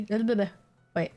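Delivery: ordinary talk, nothing marked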